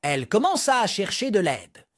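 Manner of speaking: ordinary delivery, nothing marked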